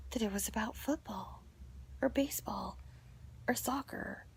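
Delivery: soft unvoiced whisper; whispered aside. Listing the sports in the texts, whispered. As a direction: trail off into a whisper.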